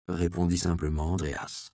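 ordinary delivery, nothing marked

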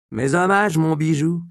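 the prostitute flirts with her darling; Magdala, cheeky. A narrator's reading. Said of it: "répondit la prostituée, avec ce sourire". (Magdala gouailleuse, plus aiguë et plus forte)

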